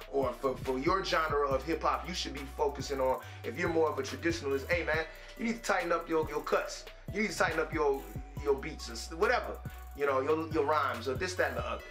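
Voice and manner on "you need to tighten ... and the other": ordinary delivery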